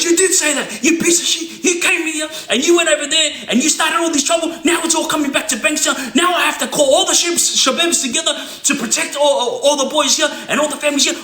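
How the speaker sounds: high, shouting accusation; imitated heated rant; furious berating. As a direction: tear into him from high up. Elder berating the other man.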